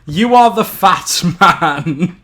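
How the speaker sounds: ordinary delivery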